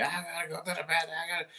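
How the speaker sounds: teacher mocking a nagging student, high and fast; mocking whiny kid. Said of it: "always one of y'all that's just like".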